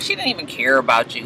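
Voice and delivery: soft, low mocking wife voice; quiet retort. Recapper voicing the wife answering her husband quietly. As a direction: drop it low and quick.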